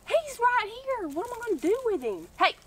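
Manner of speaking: high, exasperated, half laughing. Real, not acted. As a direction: fluster at the pest. Armadillo approaching.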